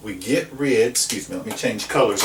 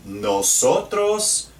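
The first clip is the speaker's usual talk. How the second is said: nosotros tomamos said slowly, syllable by syllable; slow model of nosotros tomamos; slow teacher demo; 5 semitones higher, slower than usual. Shown as a demonstration. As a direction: draw out nosotros tomamos for the class. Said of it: "What about the we form?".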